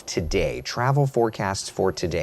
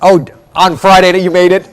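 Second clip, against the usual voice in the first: loud, high-pitched question across the room; raised voice, surprised query; calling back; 10 semitones higher, 16 dB louder than usual. Real, not acted. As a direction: project the question to someone far off. Calls back to distant colleague.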